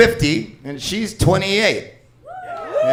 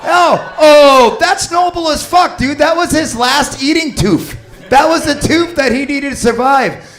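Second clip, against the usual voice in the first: mock outrage; 9 semitones higher, 5 dB louder than usual. Comic mock outrage at story.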